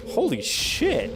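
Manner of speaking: high drawn-out amazed exclamation; stunned surprise. Real, not acted. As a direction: gasp it out slowly in disbelief. Reacting to ants making chemical.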